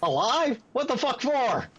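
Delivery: high-pitched, protesting character voice; incredulous objection. Acted. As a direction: protest the order in a squeal of disbelief. Character objects to capturing enemy alive.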